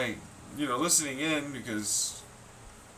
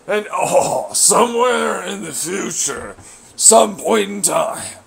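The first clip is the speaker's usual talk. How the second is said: loud, slow, high sing-song taunt; loud mocking taunt; 6 semitones higher, 13 dB louder, slower than usual. Real, not acted. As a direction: drag it out to mock them.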